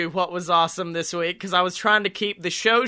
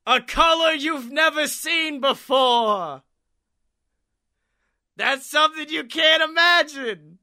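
screaming out; 8 semitones higher, 3 dB louder than usual. Acted. Villain screams his riddle answer atop the pyramid, loud and high. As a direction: bellow the answer from the pyramid top. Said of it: "he screams out".

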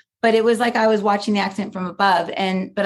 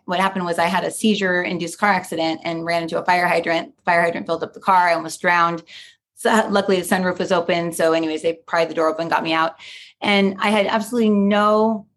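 usual speaking voice

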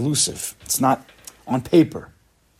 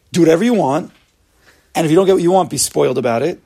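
mock spoiled demand; 5 semitones higher, 6 dB louder than usual. Mocking spoiled world attitude.

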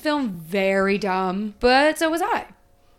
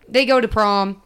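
ordinary talk

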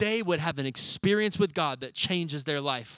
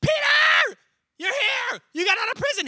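very high, squealing excitement; mock hysterical crowd greeting a friend; hammed-up shriek of joyful disbelief; freaking out; 6 dB louder than usual. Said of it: "everybody's freaking out".

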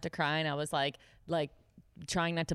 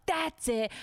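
high, emphatic acted declaration; fed-up outburst; 11 semitones higher than usual. Waitress quitting on the spot, retold angry declaration. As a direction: snap as if finally done. Retelling how she quit.

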